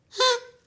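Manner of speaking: big theatrical gasp, high and loud; loud storyteller gasp; dramatic gasp. Acted. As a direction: gasp in mock shock for the kids.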